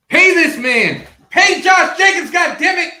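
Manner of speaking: loud, high shout. Real, not acted. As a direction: yell the demand. Demanding the team pay Jacobs.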